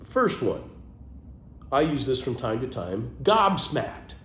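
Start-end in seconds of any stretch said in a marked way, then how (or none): 3.2-4.1 high excited announcement of the word; gobsmacked. Real, not acted. Sharing a favorite word, delighted. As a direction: say it with delight, pitched up.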